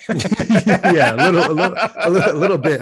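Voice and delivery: giggling out a sheepish admission; laughing. Real, not acted. Admitting to a friend he's a bit cocky, laughing loudly.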